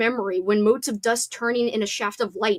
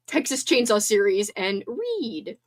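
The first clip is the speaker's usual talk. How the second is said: usual speaking voice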